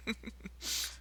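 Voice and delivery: quiet, high little chuckle; soft chuckle. Acted. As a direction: chuckle softly at your own dark joke. Chuckles at own grim joke.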